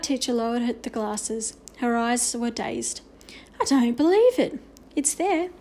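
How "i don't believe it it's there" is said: amazed. Acted. Teacher amazed.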